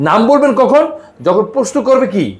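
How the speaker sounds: ordinary talk, nothing marked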